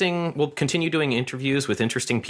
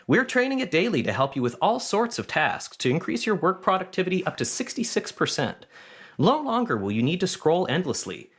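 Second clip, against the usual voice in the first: ad read; 4 semitones higher than usual. Acted. Parody ad pitch for chatbot.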